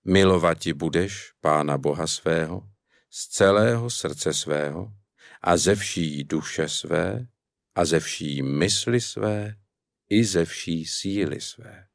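slow, measured reading of a Bible verse; solemn scripture reading. Acted. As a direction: recite the commandment slowly and reverently. Quotes Jesus' commandment slowly.

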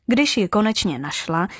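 ordinary delivery, nothing marked

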